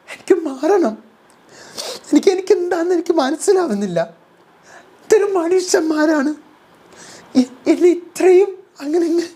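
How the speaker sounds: very high, laughing mock wail; hammed-up whiny Jasmine. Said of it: "Jasmine ഇതേ സമയം confession റൂമിൽ".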